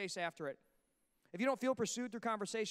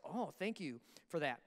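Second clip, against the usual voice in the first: dry thanks; 5 semitones lower, 4 dB quieter, slower than usual. Retold or imitated. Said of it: "I was like".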